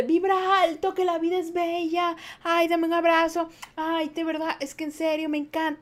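hammed-up fake cheerful gushing; mock toxic-positive person. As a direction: parody an over-sweet good-vibes type. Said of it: "el ejemplo de".

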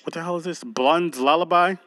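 normal talking voice

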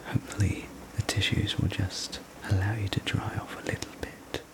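ordinary delivery

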